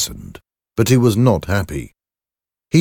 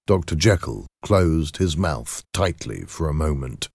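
ordinary delivery

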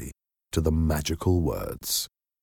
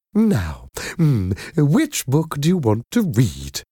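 kindly nanny voice; 8 semitones higher than usual. A narrator's reading. Madam Doubtfire voiced.

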